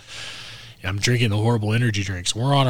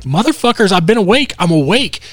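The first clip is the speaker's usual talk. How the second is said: higher, louder, fed-up outburst; exasperated yell; 8 semitones higher, 6 dB louder than usual. Retold or imitated. Driver yells at friends who slept through the deer, exasperated. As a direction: shout them down, indignant.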